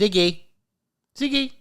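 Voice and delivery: high-pitched call to a dog. Real, not acted. Owner calling out to the dog in a high voice. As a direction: call the dog's name up high.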